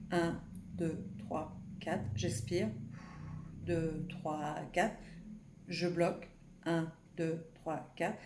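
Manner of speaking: soft, measured count with pauses between numbers; breathing count demonstration. Shown as a demonstration. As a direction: count out a breathing exercise quietly, evenly spaced. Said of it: "je vous montre pour faire l'exemple".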